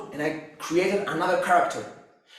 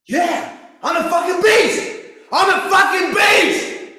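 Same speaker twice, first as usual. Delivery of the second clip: much higher, much louder, roaring at full force; pumped-up shout; 12 semitones higher, 11 dB louder than usual. Retold or imitated. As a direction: yell it to psych yourself up. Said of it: "And I'm like, when I wake up".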